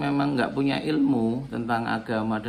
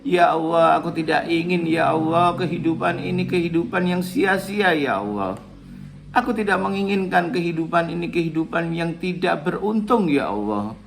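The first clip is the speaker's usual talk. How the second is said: louder, impassioned supplication; fervent prayer; 4 semitones higher, 5 dB louder than usual. Shown as a demonstration. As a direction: pour out the prayer with rising fervour. Continues praying aloud.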